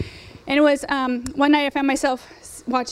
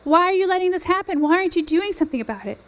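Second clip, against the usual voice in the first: retold crying out to God; 5 semitones higher than usual. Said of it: "crying out to him".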